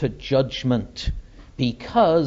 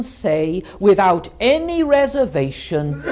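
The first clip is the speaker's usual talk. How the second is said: emphatic, ringing sermon delivery; measured, fervent pulpit oratory; fervent preaching; 8 semitones higher, 4 dB louder than usual. Acted. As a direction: preach it with weight on each phrase. Preaches to a congregation.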